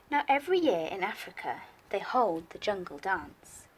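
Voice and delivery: put-on lively read-aloud for young children; bouncy rhyming picture-book reading; story narration. A narrator's reading. Teacher reads picture book to class.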